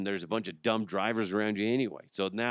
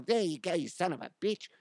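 put-on Australian accent; 6 semitones higher, 4 dB quieter than usual. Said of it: "They're all".

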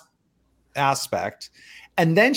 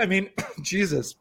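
clearing a scratchy throat; coughing, something stuck in throat; throat irritation; 4 semitones higher, 5 dB quieter than usual. Real, not acted. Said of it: "Do you have some water?".

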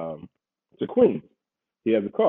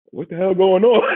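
alarmed; 8 semitones higher, 5 dB louder than usual. Retold or imitated. Four dudes hop out.

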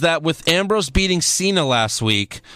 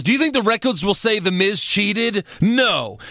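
higher-pitched, lightly acted challenge; mock Miz indignation; 6 semitones higher than usual. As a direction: lightly imitate a wrestler's scornful promo. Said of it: "And The Miz says".

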